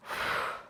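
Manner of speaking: soft puff of breath between reps; exercise exhale. Shown as a demonstration. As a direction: blow the air out on the crunch. Breathing out during crunches.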